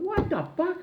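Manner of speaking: high mock squeal of disbelief; shocked squeal. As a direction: squeak it out, stunned. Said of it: "I said".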